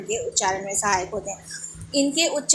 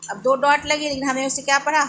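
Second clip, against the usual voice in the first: usual speaking voice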